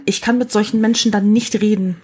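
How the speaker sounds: usual speaking voice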